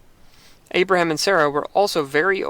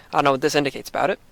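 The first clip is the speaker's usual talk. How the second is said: rushed aside; much faster than usual. Real, not acted.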